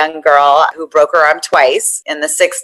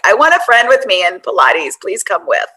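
imitating a pleading friend, pitched up; begging; 6 semitones higher than usual. Friend begging her to come along to Pilates, pleading. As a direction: plead lightly in a higher voice, as in a retelling. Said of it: "like, begging me".

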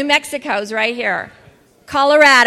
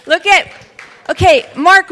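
ordinary delivery

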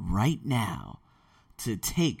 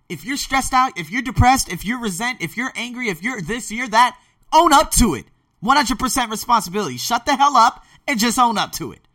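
high, loud tough-love pep talk; hard love; 10 semitones higher, 8 dB louder than usual. Real, not acted. Coach urging listeners to take responsibility, hard love. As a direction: drive each point home forcefully, much higher and louder. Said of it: "I'm gonna give you some hard love".

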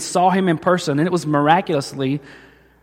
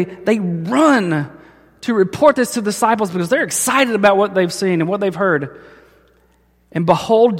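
usual speaking voice

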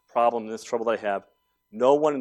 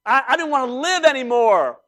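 mock anguished cry, much higher and louder; despairing plea; 13 semitones higher, 9 dB louder, slower than usual. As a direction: beg God to end it, hammed up. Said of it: "Elijah was crying".

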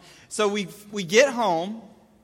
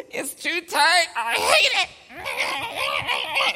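high, loud mock-toddler wail; hammed-up screechy meltdown; toddler freaking out; 11 semitones higher, 3 dB louder, much slower than usual. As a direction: imitate a tantrum, dragging out the last words. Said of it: "he just starts freaking out".

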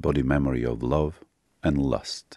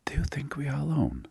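hushed, higher whispered question; 11 semitones higher, 5 dB quieter, faster than usual. A narrator's reading. Lover asking whether they are alone, whispered. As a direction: ask it in a secretive whisper.

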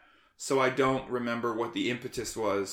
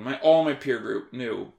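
ordinary talk

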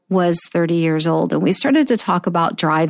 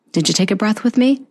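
ordinary talk

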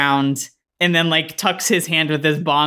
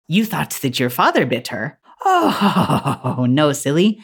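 amused, mannered lady with airy laugh; elegant woman. Acted. Elegant woman corrects Tyler, lightly amused. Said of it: "And she says".

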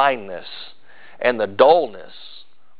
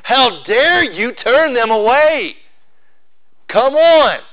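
raised, loud indignant rebuke, slow; Jesus scolding his disciples, higher and louder; angry Jesus; 7 semitones higher, 5 dB louder, slower than usual. Retold or imitated. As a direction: blow your top at the disciples. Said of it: "he was belligerent".

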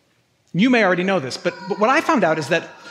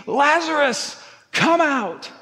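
imitated tearful scream; 8 semitones higher, slower than usual. Jesus at the tomb, tears in his eyes, restrained mock scream. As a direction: retell a scream at a raised pitch, held back in volume. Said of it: "screaming at the top of his voice".

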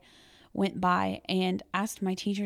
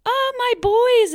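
much higher, louder, slow joyful cry; delighted; 11 dB louder, slower than usual. Retold or imitated. Teacher greeting her boys after the game, overjoyed and loud. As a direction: melt at the sight of them.

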